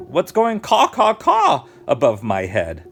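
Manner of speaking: high, loud picture-book questioning; animated read-aloud with the noise sung out high; dramatic storybook reading. Acted. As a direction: call it out big for young listeners. Reading storybook character puzzled by noise.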